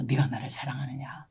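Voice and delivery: soft, low whisper of the Lord asking; hushed voice of Jesus. Retold or imitated. Preacher voicing Jesus asking her, hushed whisper. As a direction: breathe the question like a gentle divine voice. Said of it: "베드로한테 세 번 물어봤죠".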